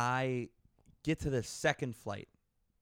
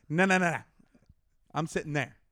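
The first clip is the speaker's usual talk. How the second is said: louder, higher insistent refusal; firm no; 4 semitones higher, 6 dB louder, faster than usual. Retold or imitated. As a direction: stand your ground with a loud no. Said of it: "and I'm like".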